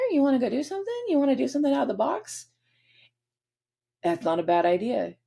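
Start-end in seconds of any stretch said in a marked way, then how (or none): 0.1-2.5 eager invite. Acted. Moon voiced urging adventure.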